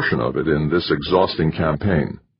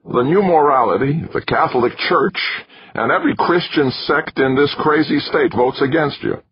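wiry manager voice; 9 semitones higher than usual. A narrator's reading. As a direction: lay it out to him like a lecture. Narrator voicing McChesney.

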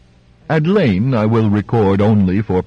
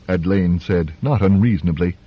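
ordinary delivery, nothing marked

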